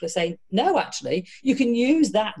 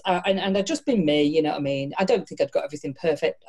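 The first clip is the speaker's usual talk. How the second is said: ordinary delivery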